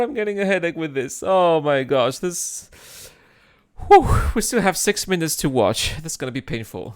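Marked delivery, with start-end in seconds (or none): none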